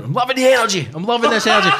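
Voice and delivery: high, loud mimicry of an overzealous host; manic insistence. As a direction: belt out the catchphrase, pitched right up. Mimics host's repeated manic catchphrase.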